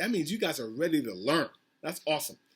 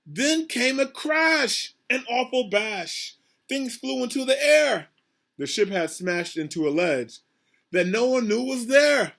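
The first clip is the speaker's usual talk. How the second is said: higher, louder storybook drama; dramatic read-aloud; 7 semitones higher, 4 dB louder than usual. Acted. Teacher reading the shipwreck to her class, dramatically. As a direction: make the crash big for the kids.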